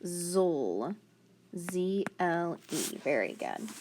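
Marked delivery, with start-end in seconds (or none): 0.0-0.9 "zol" held long; drawn-out "zol", set apart; separated final syllable. Shown as a demonstration. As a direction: stretch "zol" as a syllable of its own. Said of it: "And then I add".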